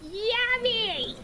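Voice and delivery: high, drawn-out "yummy"; playful delight. Real, not acted. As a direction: squeal it lightly with relish. Delight at cherries in game.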